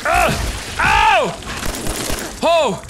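short, loud cries of "ow"; pained yelps. Real, not acted. Player getting hit in the game, pained yelps. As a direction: yelp at each hit.

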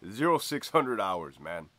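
usual speaking voice

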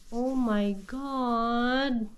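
ordinary delivery

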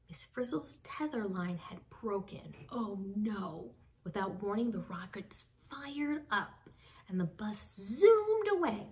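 usual speaking voice

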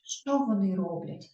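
ordinary talk, nothing marked

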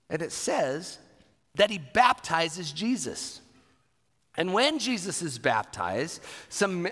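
ordinary delivery

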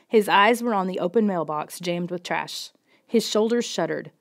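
ordinary delivery

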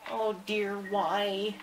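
ordinary talk, nothing marked

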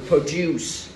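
produce slowly as pro-DUCE; short pro, drawn-out DUCE in produce; stress on second syllable. Shown as a demonstration. Teacher shows the verb form, second-syllable stress. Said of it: "còn khi nào âm thứ hai?".

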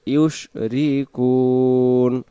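slow melodic tajweed chant; Quran recitation. Acted. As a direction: recite slowly with long drawn vowels.

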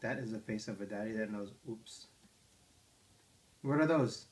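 usual speaking voice

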